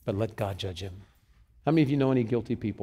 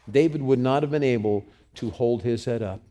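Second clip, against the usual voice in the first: ordinary talk, nothing marked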